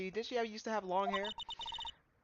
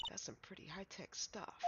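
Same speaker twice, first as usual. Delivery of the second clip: hushed whisper; 18 dB quieter than usual. Acted. Awed hushed remark.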